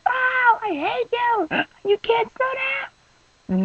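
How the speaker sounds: very high, slow, strained cry of frustration; frustrated yell. Retold or imitated. Stranded driver at passing cars, high drawn-out whine. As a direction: whine it at the cars flying past.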